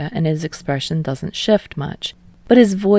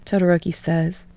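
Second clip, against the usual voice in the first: ordinary delivery, nothing marked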